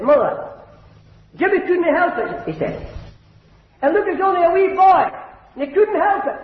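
put-on high childlike voice begging his mother; pleading little boy. Small boy begging his mother to spare his brother, high pleading voice.

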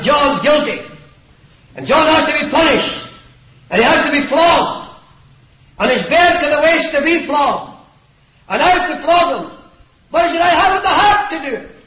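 high, loud preaching delivery; impassioned schoolmaster's address. Acted. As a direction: declaim it to the whole hall, anguished. Preacher voices doctor addressing the school.

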